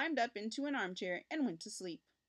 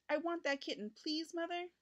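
put-on higher pleading child voice; begging boy; 7 semitones higher than usual. A narrator's reading. Richard begs his mother for the kitten, high pleading. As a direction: plead with mother, eager and small. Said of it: "begged Richard".

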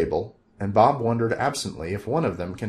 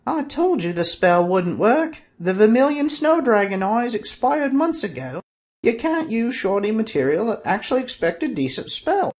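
little woman's voice; 13 semitones higher, 4 dB louder than usual. Acted. Woman scolding the sorcerer over expired dragon eyes, very high. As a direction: nag him, high-pitched and shrill-sounding. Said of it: "the little woman was saying".

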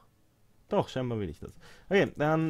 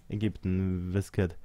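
ordinary talk

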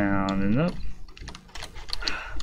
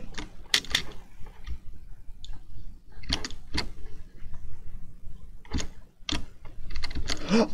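long breathless gasp; sharp amazed intake of breath; 11 semitones higher, 7 dB quieter than usual. Real, not acted. Clears the level.

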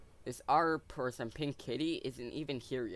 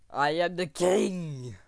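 slow, loud, booming royal proclamation; roaring tyrant king; 5 semitones higher, 8 dB louder, much slower than usual. Pretend. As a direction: bellow it like a tyrant on his throne. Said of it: "I don't actually act like this".

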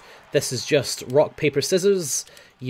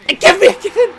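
frustrated yell; 13 dB louder than usual. Real, not acted. Gaming frustration outburst.

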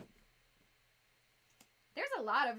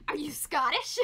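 loud, mock-incredulous question; imitated disbelief; 2 semitones higher, 8 dB louder than usual. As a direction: blurt it like an astonished American. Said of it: "Americans are like".

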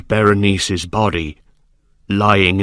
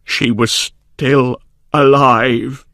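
horrified servant; 3 semitones higher, 4 dB louder than usual. A narrator's reading. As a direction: haltingly reveal the horror, rising in pitch at the end. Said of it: "wild with fear".

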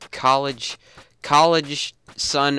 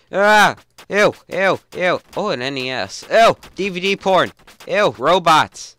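loud, higher grossed-out ews; disgusted ew; 7 semitones higher, 8 dB louder than usual. Real, not acted. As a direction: recoil in disgust, drawing it out. Disgusted at items on shelf.

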